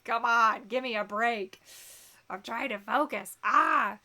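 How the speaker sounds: fed up, snapping at the tickling hair; exasperated outburst. Real, not acted. Hair tickling her face.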